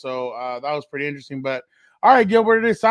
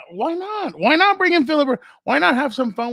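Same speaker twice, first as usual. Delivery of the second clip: excited pitch; 10 semitones higher, 5 dB louder than usual. Real, not acted. Pushing Phillip Rivers idea excitedly.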